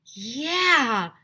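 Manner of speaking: high, loud, drawn-out agreement; eager loud yeah. A narrator's reading. Follower girl agreeing with Andrea, eager cheer. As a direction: jump in to agree right away.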